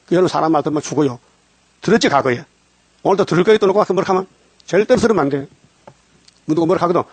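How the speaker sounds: normal talking voice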